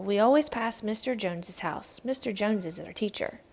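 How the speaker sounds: ordinary delivery, nothing marked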